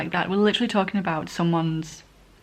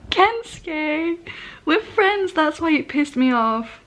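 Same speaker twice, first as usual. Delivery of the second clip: much higher put-on voice for the character; 8 semitones higher, much slower than usual. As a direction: pitch way up and act the line out. Voicing a character in the scene.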